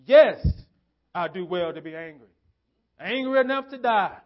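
firm, indignant answer with a sharp loud yes; answering back in self-righteous anger; angry reply. Acted. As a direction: Jonah talking back to God, indignant. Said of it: "in anger and his sense of entitlement and self-righteousness".

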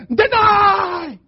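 high, loud, drawn-out yell; Paul. Retold or imitated. Paul on the sidelines of the faith, yelling down at us. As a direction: yell it down at them like a coach. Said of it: "he's yelling down at us going".

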